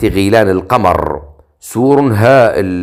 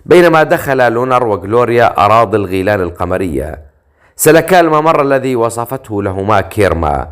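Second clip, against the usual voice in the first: ordinary delivery, nothing marked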